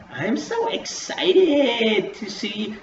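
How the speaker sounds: high, slow gushing mock voice; mocking Nikki. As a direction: drag it out like a fake-sweet fan. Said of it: "And then Nikki's like".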